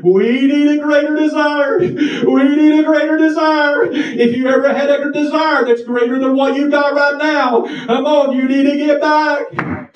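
fervent altar-call exhortation; preaching at full force. Real, not acted. Preacher building to a climax.

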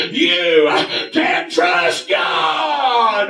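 high, gasping revival cadence; exhorting pulpit shout; fervent preaching. Real, not acted. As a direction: drive each phrase home, ending on a long cry. Preaches at full force.

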